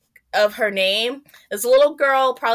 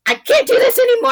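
high, loud cry of giving up; desperate outburst; 13 semitones higher, 6 dB louder than usual. Retold or imitated. Woman in transition crying out to everyone, loud and high. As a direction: burst out like you've hit your limit.

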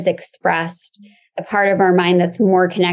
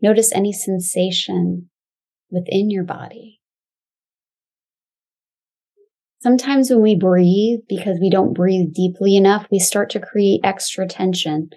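calm grounding exercise. Acted. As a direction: guide them gently into the body.

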